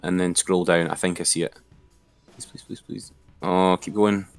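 usual speaking voice

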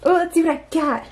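ordinary talk

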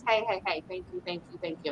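hushed, breathy-soft thanks; quiet imitated dog murmuring thanks. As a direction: whisper the thanks gently. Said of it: "So that one is like".

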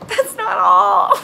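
slow, loud, tearful cry; tearful outburst, slow and loud; crying shout. Real, not acted. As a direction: burst out, near sobbing.

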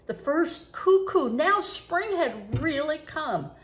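ordinary delivery, nothing marked

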